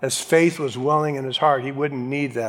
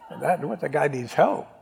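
ordinary talk

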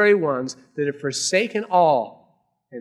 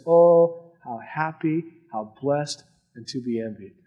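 tender; 3 semitones lower than usual. Acted. Jesus speaks of the poor.

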